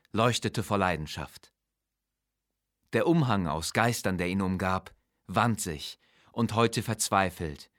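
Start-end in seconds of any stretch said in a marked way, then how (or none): none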